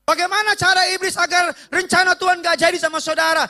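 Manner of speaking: high, urgent sermon delivery; impassioned preaching. Acted. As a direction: preach it over the crowd. Preaching at force.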